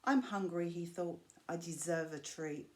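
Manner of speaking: usual speaking voice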